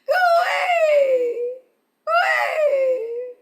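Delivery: high, loud squawking bird cries; go-away bird call. Shown as a demonstration. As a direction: shout it like a grumpy bird. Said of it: "say what seems".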